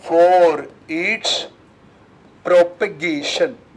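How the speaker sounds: very slow, drawn-out words to copy down; slow dictation. Shown as a demonstration. Teacher dictating the definition's end to the class. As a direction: spell it out for the notebook. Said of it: "definition में क्या लिखूं".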